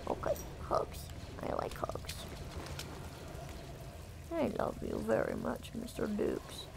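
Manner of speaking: soft, half-whispered affection; tender cat talk. Real, not acted. Streamer to the cat being hugged, tender and hushed. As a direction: murmur it softly to a pet.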